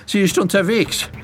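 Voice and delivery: high, breathless warning in short bursts; winded, alarmed boy; gasping for air. Acted. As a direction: pant out the news urgently. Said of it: "noch nach Luft schnappte".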